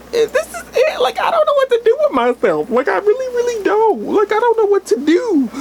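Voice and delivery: high, breathless, teary rush; overwhelmed squeal. Real, not acted. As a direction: gush it out, can't hold it together. Overwhelmed by the film's ending.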